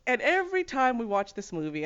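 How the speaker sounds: normal talking voice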